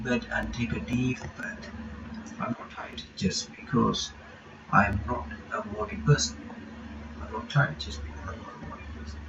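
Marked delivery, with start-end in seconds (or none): none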